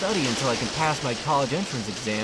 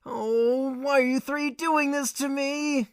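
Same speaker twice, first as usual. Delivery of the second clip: whiny plea; 9 semitones higher, slower than usual. Acted. Student whining at neighbours.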